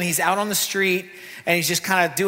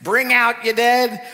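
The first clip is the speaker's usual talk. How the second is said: slow, louder call of a cart man; cart man's call; 5 semitones higher, 4 dB louder, slower than usual. Retold or imitated. Preacher imitating Monty Python cart man, slow call. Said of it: "it's going".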